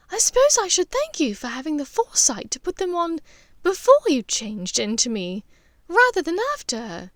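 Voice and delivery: wry mother teasing her son; lightly voiced Narcissa. Narcissa amused reply.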